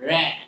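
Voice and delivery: "র্যাট" with the T swallowed; silent final T. Shown as a demonstration. Teacher repeats "র্যাট" for learners, T silent. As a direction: end "র্যাট" on the vowel. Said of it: "আমরা প্রনাউন্সিয়েশনে কি বলবো?".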